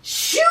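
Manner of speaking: long, loud, high "shoo" blast; hammed-up gusting sneeze sound; mock bear sneeze. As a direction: let out a huge drawn-out sneeze. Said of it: "'cause he's going".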